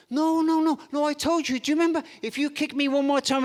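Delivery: exasperated parent repeating the warning; insistent refusal. Acted. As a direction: refuse firmly in a rising, agitated voice. Mum firmly refuses, acted quarrel.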